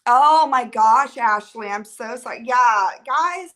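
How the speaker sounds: usual speaking voice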